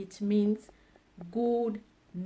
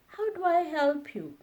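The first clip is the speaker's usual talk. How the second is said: ordinary talk, nothing marked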